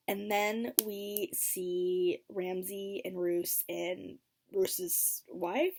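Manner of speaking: ordinary delivery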